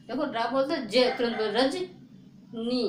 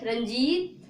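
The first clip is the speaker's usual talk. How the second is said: Ranjit with जी stretched out; stressed long vowel; much slower than usual. Shown as a demonstration. Teacher demonstrating where force falls, Ranjit with long जी. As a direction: lean on the जी of Ranjit. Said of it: "Ranjit में थोड़ा जोर लगता है".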